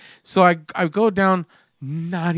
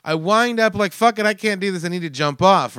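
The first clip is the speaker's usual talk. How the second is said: retold panic; 7 semitones higher than usual. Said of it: "I'm fucking freaking out".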